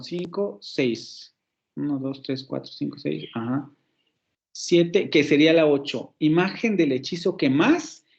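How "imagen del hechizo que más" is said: "Imagen del hechizo que más" with MÁS long and rising; stress on eighth syllable. Shown as a demonstration. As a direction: climb onto a stretched "más". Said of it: "vuelve a caer el acento en la octava sílaba".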